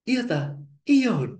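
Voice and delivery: high incredulous really?; surprised disbelief. Retold or imitated. Hendra hearing he got the bank job, high surprise. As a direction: jump up in pitch, unable to believe it.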